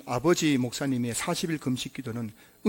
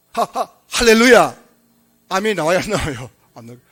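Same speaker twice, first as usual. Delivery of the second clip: loud, halting stammer on each first syllable; imitating a stutter; 4 semitones higher, 11 dB louder, slower than usual. Preacher imitating his stuttering father giving a sermon, loud halting stammer. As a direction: stretch it out, stumbling over every opening sound. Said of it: "좀 흉내를 내볼게요. 이렇게 했다".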